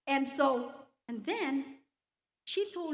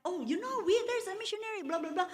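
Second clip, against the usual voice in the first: high, louder retold gushing; excited chatter; 7 semitones higher, 4 dB louder, much faster than usual. Children telling mom and dad about the missionary, high excited chatter. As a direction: imitate yourself babbling news at a higher pitch. Voices her excited report to parents.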